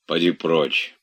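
ordinary delivery, nothing marked

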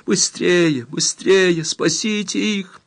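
high-pitched, anxious plea; tearful urging. A narrator's reading. Girl urges men to save her friends, pleading tearfully. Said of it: "повторила Баэдера со слезами на глазах".

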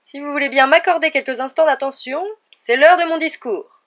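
loud, high public announcement. A narrator's reading. Ghost host calling guests to his speech, loud. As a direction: call the crowd to attention, slowing at the end. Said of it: "dit Nick d'une voix forte".

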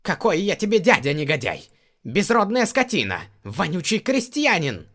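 high, loud furious scream. Acted. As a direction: scream the insults at him. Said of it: "завопил Хессель".

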